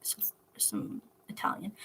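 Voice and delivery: unvoiced mumbled aside; muttered aside. Real, not acted. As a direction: mutter it under breath.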